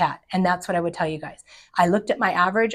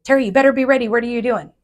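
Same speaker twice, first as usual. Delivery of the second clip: higher, louder mock-urgent nagging; husband nagging; 5 semitones higher, 5 dB louder, faster than usual. Husband pesters wife about the downturn, raised mock-urgent nagging. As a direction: press her like a worried husband. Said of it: "my husband repeated this to me".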